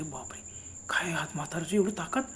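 whispered gasp of disbelief; frightened whisper. Retold or imitated. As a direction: whisper it in terror. Frozen in fear at the ravine.

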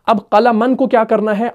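normal talking voice

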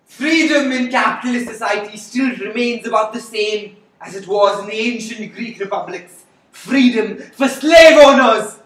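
builds to a loud cry; declaim, ending in a shout; fiery revolutionary speech. Acted. Continues speech despite host.

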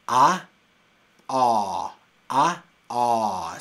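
A repeated loud, open and throaty; exaggerated English A. Shown as a demonstration. Teacher drilling the exaggerated English A. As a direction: keep hammering the open A. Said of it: "Estoy exagerando".

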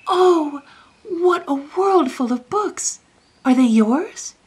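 put-on high, loud childlike wonder; amazed cry. A narrator's reading. Young king first sees a world of books, crying out amazed. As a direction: cry out in delighted astonishment. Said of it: "when Lionel cried out".

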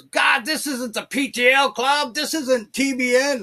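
high, loud acted gripe; exasperated protest. Would-be TV preacher stuck under a toilet, mock exasperation. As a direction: wail it to God in mock outrage.

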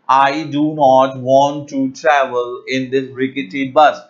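slow, clear teaching read of the sentence; slow dictation-style example. Shown as a demonstration. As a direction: lay out each word slowly for learners. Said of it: "So the example is".

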